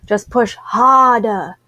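put-on drawn-out African-accented urging to push harder. Nurse urging the mother to push, in an African accent. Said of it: "she's saying it like that 'cause I remember her telling me she's from Africa, so she has this accent".

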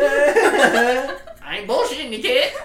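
slow, loud, high Tiger King drawl. Acted. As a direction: lay it on like Joe Exotic. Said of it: "Our Tiger King voice".

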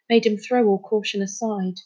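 ordinary delivery, nothing marked

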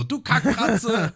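hoch, laut, sehr langsam geschimpft; wütender Ausruf. Retold or imitated. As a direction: beschimpf sie übertrieben schrill. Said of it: "Und Gänse so". (hohes, langsames Schimpfen)

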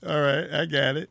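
ordinary talk

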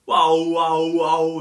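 loud, drawn-out mimicked wails; imitated screaming. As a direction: imitate someone screaming over and over. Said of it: "ela grita e começa tipo".

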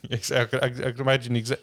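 speaking through laughter; laughing. Real, not acted. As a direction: still laughing while talking. Laughing after the bit.

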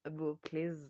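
usual speaking voice

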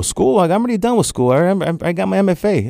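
high, disbelieving protest; incredulous. Retold or imitated. Artist to his mentor, high and incredulous.

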